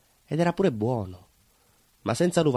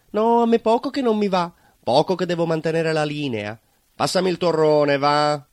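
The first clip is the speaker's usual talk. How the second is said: higher, louder put-on voice of a guest refusing panettone; a guest at Christmas dinner turning down panettone, mock fussy; mock dieting guest; 6 semitones higher, 5 dB louder than usual. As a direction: play the fussy guest who wants torrone instead. Mocking people who skip panettone.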